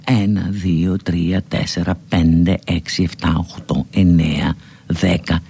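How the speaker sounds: χαμηλό, καθαρά αρθρωμένο μέτρημα; μέτρα χαμηλόφωνα συλλαβή προς συλλαβή; μάθημα ορθοφωνίας. Shown as a demonstration. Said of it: "Αρχίστε λοιπόν". (επίδειξη σωστής άρθρωσης στους αριθμούς)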